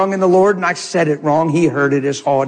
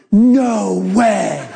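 amazed laugh; 3 semitones higher than usual. Retold or imitated. Driver recognizes him, amazed.

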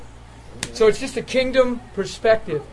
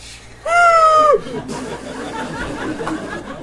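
high wailing cry like a spigot; imitated wailing sob; 3 dB louder than usual. Preacher imitating Terry crying uncontrollably, high wail. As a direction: let the tears burst out, howling.

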